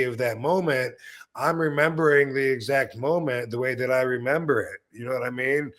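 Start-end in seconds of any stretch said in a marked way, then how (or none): none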